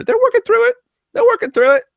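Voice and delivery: joking that the snowless snowshoe testers persevere; mock cheerful squeak. Joke, squeaky repeat.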